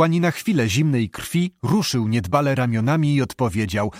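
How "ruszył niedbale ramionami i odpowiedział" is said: usual speaking voice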